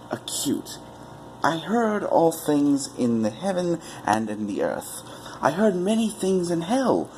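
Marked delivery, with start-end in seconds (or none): none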